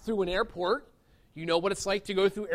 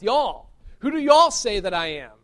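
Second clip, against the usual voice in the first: a light Southern accent on "Y'all", drawled out and louder; 7 dB louder than usual. Shown as a demonstration. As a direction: voice it as a Southern preacher would. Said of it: "as they say in the South".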